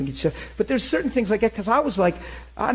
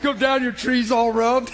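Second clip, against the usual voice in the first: laughing through it; 7 semitones higher than usual. Retold or imitated. Laughing retelling.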